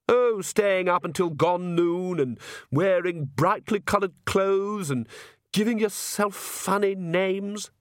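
high, slow, drawn-out listing; disapproving countess mother. Acted. Countess lists her daughter's crowd's habits, primly disapproving. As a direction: list each fad with prim disdain.

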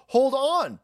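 sudden high "hold on"; startled high voice. Retold or imitated. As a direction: jump up high in surprise. Acting Hunter on phone.

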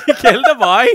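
high, loud Scottish mimicry; laughing, hammed-up Scottish accent; mock Scottish accent.

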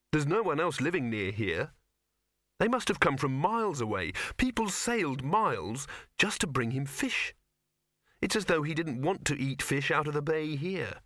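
lightly voiced puzzled young hero; Victor.

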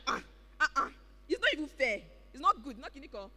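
a mild whining protest; complaining. Retold or imitated. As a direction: grumble that it's unfair. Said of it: "then you be like".

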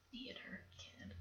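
hushed aside, breathy whisper. Acted. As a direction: drop to a whisper for the reveal. Gossiping a secret detail.

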